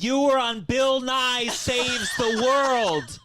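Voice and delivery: high, loud, drawn-out mock yell; hammed-up doctor yelling; imitated yelling doctor. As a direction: bellow it accusingly, stretching each word. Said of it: "she was yelling at me".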